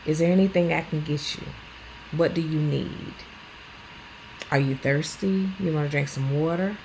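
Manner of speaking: soft caring carer checking in; gentle caring questions. Retold or imitated. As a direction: coax them gently. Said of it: "Ask them something like".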